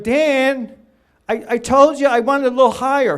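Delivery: high, loud, angry mock yell at the photographer; imitated yelling. Client yelling at Dan over the camera angle, loud and angry. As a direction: bark it like a pushy client scolding Dan. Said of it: "he would yell at me".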